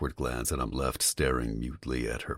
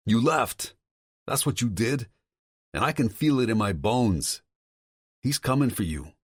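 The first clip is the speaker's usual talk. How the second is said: higher, agitated male voice on the phone; a worried friend pushing hard, voice raised in pitch; Tom's voice; 7 semitones higher, 3 dB louder than usual. Acted. Tom warning Vincent about his father, higher and agitated.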